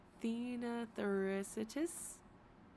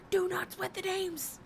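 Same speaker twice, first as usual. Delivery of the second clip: a much higher, louder mock yell; 12 semitones higher, 3 dB louder than usual. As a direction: yell it up high in a put-on voice. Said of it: "Why are you yelling at me?".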